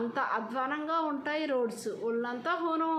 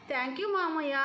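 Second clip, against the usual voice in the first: higher, bright-spirited thanks; happily; 4 semitones higher than usual. A narrator's reading. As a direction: thank uncle with real delight, pitched up. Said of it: "సంతోషంగా అది".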